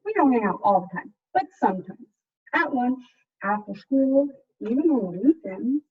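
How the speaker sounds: put-on lively picture-book narration for kids; story reading. A narrator's reading. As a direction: read it like a storyteller to a class. Teacher reads picture book aloud.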